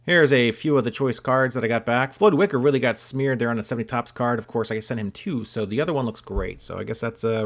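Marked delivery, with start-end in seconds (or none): none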